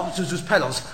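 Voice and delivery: soft, low, amazed; hushed wonder. Acted. George finding Zuzu's petals in his pocket, hushed awe. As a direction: breathe it out in disbelief.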